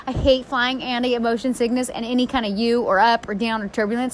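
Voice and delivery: normal talking voice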